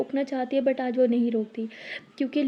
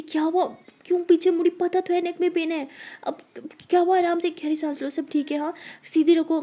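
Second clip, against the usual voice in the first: higher, anxious rush of concern; worried; 6 semitones higher than usual. Acted. Friend panics over the girl's hurt neck, worried. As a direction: fuss over her in alarm.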